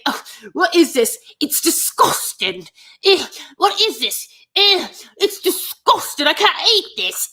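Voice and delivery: high, loud, grossed-out whine; disgusted diva. Retold or imitated. Podcaster mocking the picky girlfriend, high disgusted whine. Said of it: "She's keeps saying".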